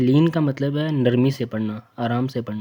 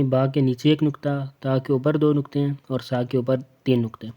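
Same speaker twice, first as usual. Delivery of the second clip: ordinary talk, nothing marked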